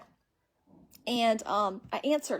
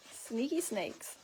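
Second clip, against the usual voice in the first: murmured sneaky snakes; 9 dB quieter, much slower than usual. Real, not acted. Comment on scammers.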